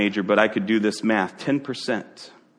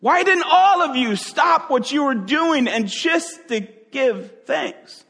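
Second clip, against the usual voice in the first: hammed-up aggravation; 14 semitones higher than usual. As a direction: press them in mock frustration. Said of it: "He was kind of aggravated".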